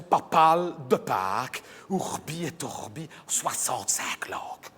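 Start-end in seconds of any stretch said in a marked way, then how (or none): 1.9-4.7 low, slow solemn intoning; solemn papal intoning. Retold or imitated. Mocking the pope's Easter blessing, slow low intoning.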